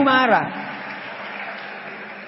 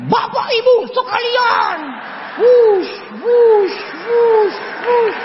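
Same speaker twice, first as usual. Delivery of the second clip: high shouted MC blowing through his mustache; mock MC shouting, air hissing out; mustached MC spraying words; 12 semitones higher, 3 dB louder, much slower than usual. MC spraying the crowd through a thick mustache, shouting. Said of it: "M C ku kemarin ... kumisan".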